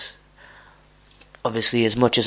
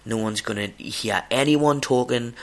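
ordinary talk

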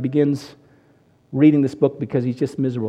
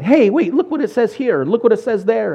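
excited, higher-pitched call; eager urging; 6 semitones higher than usual. Retold or imitated. Husband waking his wife to show her his Bible, eager. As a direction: urge a sleeper to look, voice lifted.

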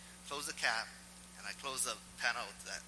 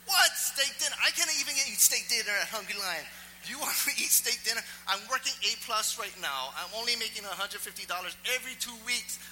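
high, loud, incredulous protest; comic indignant outburst, pitched way up; outraged inner rant; 10 semitones higher, 6 dB louder than usual. Acted. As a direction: rant in disbelief at the nerve of the request. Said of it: "I was thinking that in my head".

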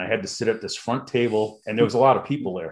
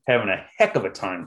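ordinary delivery

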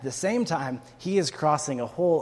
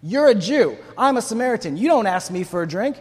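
loud, higher-pitched indignant retort; sharp response; 4 semitones higher, 6 dB louder than usual. Acted. Samaritan woman rebuking a man who asked for a drink, sharp. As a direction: snap back loudly, punching each accusation. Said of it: "Her response was sharp".

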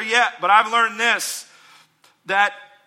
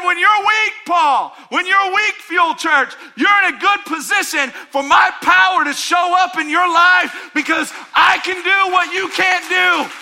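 loud, higher, declaring power; God's promise; 9 semitones higher, 6 dB louder than usual. Acted. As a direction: proclaim it as God to the church. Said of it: "He said".

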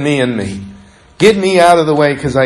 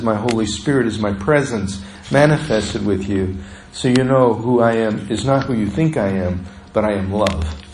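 tender God voice; 5 semitones lower, 5 dB quieter than usual. Acted. God speaks tenderly to listeners.

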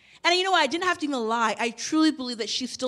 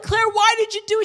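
high, loud, fast angry question; angry demand; 9 semitones higher, 7 dB louder, faster than usual. Retold or imitated. Mom confronting daughter over haircut, angry high and fast. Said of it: "I was angry at the moment".

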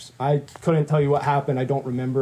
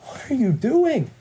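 slow, high-pitched plea; pleading bewilderment; 9 semitones higher, slower than usual. Retold or imitated. Questioning God amid sickness and ruin, pleading.

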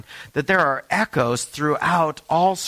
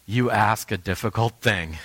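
lower, weighed-down reply; weary prophet; 4 semitones lower than usual. Pretend. Tired prophet warning the eager follower, heavily. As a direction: answer like a worn-out prophet who knows the cost. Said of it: "you might say".

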